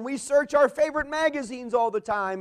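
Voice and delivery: ordinary talk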